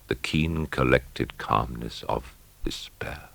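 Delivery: slow, hushed whisper of despair; a slow, still whisper; whispered calm. A narrator's reading. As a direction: let the calm settle into a whisper.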